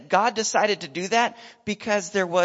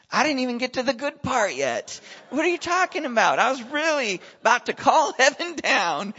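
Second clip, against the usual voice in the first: higher and louder, playfully indignant; mock outrage; 8 semitones higher, 6 dB louder than usual. Preacher miffed the girl healed too early, high and loud. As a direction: act cheated out of the big finish.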